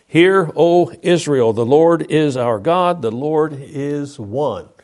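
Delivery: loud, slow, solemn declaration; scripture proclamation. Acted. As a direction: proclaim it to the congregation, each phrase weighty. Reads scripture aloud.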